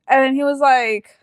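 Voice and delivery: ordinary delivery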